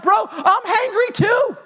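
slow, high, hammed-up complaint; mock hangry whine out the car window; hangry bro. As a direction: drag it out like a cranky dude. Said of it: "wanted to come out and be like".

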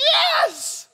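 very high, loud burst; emphatic outcry. Acted. Preacher answers whether culture is choking us, emphatic cry. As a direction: cry it out with full force.

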